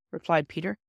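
plain reading voice

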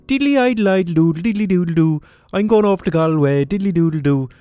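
high, loud, hammed-up stage-Irish singsong; mock Irish singsong. Mocking a clichéd Irish song, hammed-up stage-Irish singsong. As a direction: sing it like a cheesy fake Irish ditty. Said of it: "like, an Irish sounding song".

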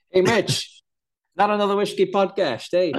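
put-on high, hammy Dutch accent greeting. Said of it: "do the introduction with a Dutch accent".

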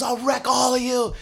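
high mock shout; drunken threat. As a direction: boast like a stumbling drunk.

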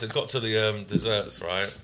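ordinary delivery

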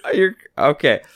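normal talking voice

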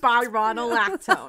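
spironolactone starting spy-RON; spy-RON-oh-LAC-tone. Shown as a demonstration. Co-host correcting the reader, full spy-RON-oh-lactone.

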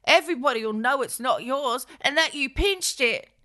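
high, worried sidekick voice; nervous companion. Acted. Bird warns Jackie against the theft, high and anxious.

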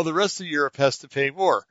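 ordinary talk

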